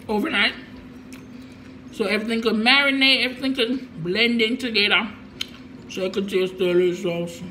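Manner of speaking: talking between bites; chewing rice while talking. Real, not acted. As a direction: mouth partly full. Seen 3: Rice spooned in at -5, lips keep working; more rice at 9.4.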